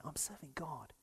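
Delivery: whispered, tender reminder; hushed assurance. Real, not acted. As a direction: breathe it as a gentle comfort. Soft reassurance to congregation.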